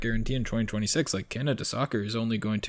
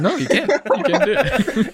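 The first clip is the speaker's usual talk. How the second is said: laughing; 14 semitones higher, 5 dB louder than usual. Real, not acted. Laughing denial of the accent.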